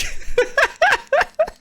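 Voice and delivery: a sudden high laugh at his own joke; high burst of laughter. Real, not acted. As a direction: break into a squealing laugh.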